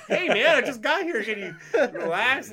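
high, loud laughing complaint; hammed-up fed-up plea; exasperated protest. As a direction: protest at being put on show. Acted protest to his friend.

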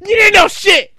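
high, loud, stumbling retort; mock furious comeback. Husband calling his wife's bluff in bed, mock furious yelling. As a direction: shout back in hammed-up indignation. Husband yells back in retold quarrel.

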